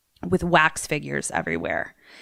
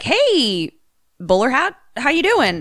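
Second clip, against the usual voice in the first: higher-pitched, lightly acted hello; mock friendly greeting; 7 semitones higher than usual. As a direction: lightly voice a movie character's greeting. Voices V P greeting his partner.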